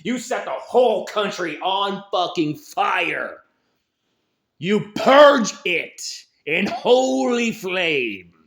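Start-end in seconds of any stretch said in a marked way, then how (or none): 4.6-8.3 loud, slow and higher, like a war cry; fervent shout. Real, not acted. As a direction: roar it out slowly like a sermon of fire. Ranting call to violence.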